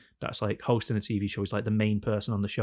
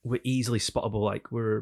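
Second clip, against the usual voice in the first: ordinary delivery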